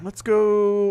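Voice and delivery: loud, high cheer; excited cheer. Real, not acted. Cheering on a dialogue choice.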